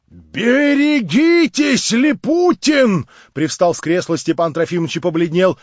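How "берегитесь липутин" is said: медленно, выше и громче, с угрозой; протяжный предостерегающий окрик; грозное предостережение. Acted. (грозный окрик Липутину)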